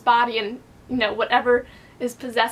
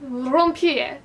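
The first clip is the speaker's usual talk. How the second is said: Rompier in a mock French accent, falling. Shown as a demonstration. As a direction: show off a playful French Rompier. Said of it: "My lovely French accent".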